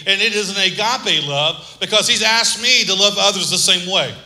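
rising, emphatic sermon delivery; preaching. Acted. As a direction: preach it to the congregation.

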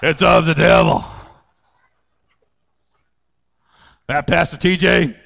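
low, prim disapproving visitor; mock churchgoer.

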